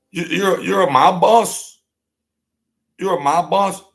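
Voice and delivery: high, loud incredulous taunt; mocking disbelief. Real, not acted. Mocking the so-called mob boss, high and loud. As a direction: sneer it like it's a joke.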